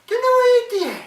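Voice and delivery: high, loud long call across the sea. Acted. Mother calling her whale brother from shore, loud high call. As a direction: call your brother from afar.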